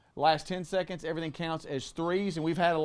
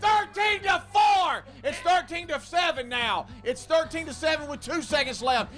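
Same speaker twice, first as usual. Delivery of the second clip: rising, louder excited call; excited shout; 10 semitones higher, 3 dB louder than usual. Real, not acted. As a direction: yell the score as it climbs. Close game finish, calling score.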